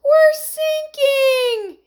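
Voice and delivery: very high, slow wail; panicked shout. A narrator's reading. Heidi in the sinking boat, shouting. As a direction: stretch it into a loud high cry. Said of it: "shouted Heidi".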